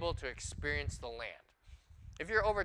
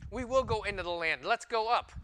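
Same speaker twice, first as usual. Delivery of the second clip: higher, louder hurried turnaround; lightly voiced eager crowd; mock eager change of heart; 5 semitones higher, 5 dB louder than usual. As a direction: act the people suddenly keen. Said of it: "the people turned and said".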